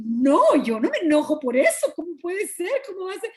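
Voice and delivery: high, heated protest re-enacted; indignant denial. Retelling her denial of anger to the congregation, high mock indignation. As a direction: protest it high, starting loud. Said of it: "Se me subió más todavía la, cosa burbujeante".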